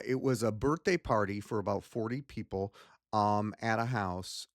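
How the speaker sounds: ordinary talk, nothing marked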